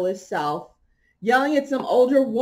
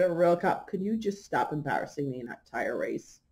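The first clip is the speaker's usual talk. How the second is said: quiet; 6 semitones lower, 8 dB quieter than usual. Retold or imitated. Calmly scolding a stranger.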